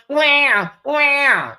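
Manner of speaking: high, short mock baby cries wham wham; imitated baby wail. Mimicking the new baby crying to her viewers, mock wail. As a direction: squall like a bawling baby, half joking. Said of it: "Y'all know how them baby crying".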